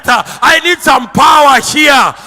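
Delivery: loud, rising preacher's cry over music; preaching at full force. Acted. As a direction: preach it out, half singing.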